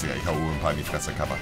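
usual speaking voice

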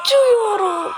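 high, loud wailing cry. Real, not acted. Gamer launched into the air, loud wailing cry. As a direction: yell it while flying off.

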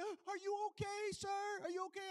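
high, concerned nurse voice; high sweet nurse asking if he's okay; mock nurse voice. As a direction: fuss over him like a worried nurse.

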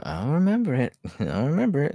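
high, loud mock cheer with laugh; bitter joking; sarcastic. Real, not acted. Sarcastic after being hit.

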